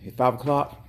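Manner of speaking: usual speaking voice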